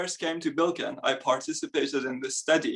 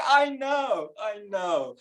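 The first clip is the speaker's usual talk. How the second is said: meek mock agreement; 6 semitones higher, slower than usual. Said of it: "I'm like".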